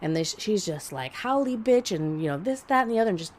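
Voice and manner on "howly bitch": mild mock yell, pitched up; imitated screaming insult. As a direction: spit the insult higher, without full volume. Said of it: "basically just screaming and carrying on".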